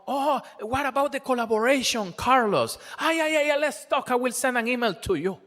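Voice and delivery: high, quick breezy brush-off; mock eager promise. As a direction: play someone agreeing warmly with no intention to follow up. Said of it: "And then I said".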